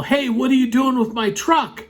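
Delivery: high-pitched mock yell; retold yell. Truck owner confronts tow driver, mock yelling. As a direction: imitate someone yelling, pitch well up. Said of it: "you hear that somebody else yell".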